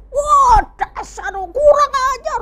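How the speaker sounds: put-on high, slow, sneering kid's curse; mock outraged gamer boy; rude kid gamer. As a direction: spit it out like a bratty gamer kid. Kid swearing while gaming.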